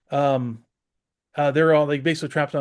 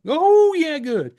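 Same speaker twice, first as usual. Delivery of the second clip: much higher, louder hammed-up Cajun "Oh, yeah"; Cajun impression; 13 semitones higher, 6 dB louder, much slower than usual. Mimicking a Cajun local's reply, hammed-up accent. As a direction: toss it off like a cheerful Cajun. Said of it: "That's how they would talk to you".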